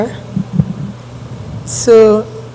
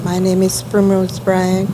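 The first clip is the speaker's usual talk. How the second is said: soft, low-level introduction; barely audible; 3 semitones lower, 6 dB quieter than usual. Real, not acted. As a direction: say it too softly to carry. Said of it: "Can you speak up, please?".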